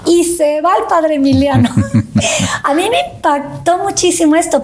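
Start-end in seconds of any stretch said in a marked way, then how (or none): 1.7-2.6 light laugh. Real, not acted. Laughs after the story.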